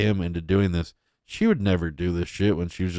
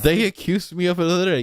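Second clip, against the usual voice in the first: mocking repeat; 9 semitones higher, faster than usual. Repeats clip line mockingly.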